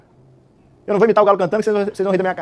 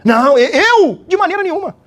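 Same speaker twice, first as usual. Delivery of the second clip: high, loud, indignant Peter insisting; protesting Pedro; 10 semitones higher, 4 dB louder than usual. Acted. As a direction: swear loyalty, higher and louder. Pedro protests his loyalty to Jesus. (Pedro protestando alto e agudo que nunca vai negar Jesus)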